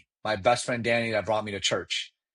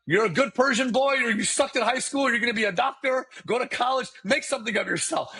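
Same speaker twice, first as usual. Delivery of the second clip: much higher, hammed-up voice of nagging Persian parents; mock Persian parent; 11 semitones higher than usual. Mocking his Persian parents' career expectations, high hammed-up voice. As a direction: parody the family's lecture in a high, mocking whine.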